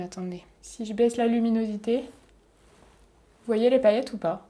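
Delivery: ordinary talk, nothing marked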